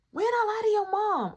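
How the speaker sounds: very high, incredulous question; indignant denial. Retold or imitated. She denies the accusation.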